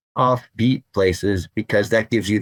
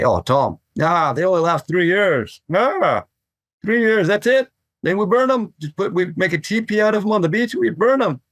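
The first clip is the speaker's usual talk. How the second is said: much higher-pitched impression of an older mentor; 10 semitones higher than usual. Mentor waving off Tom's worry about hollow boards, high mock voice. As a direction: imitate a mentor in a high, put-on voice. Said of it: "he would just say".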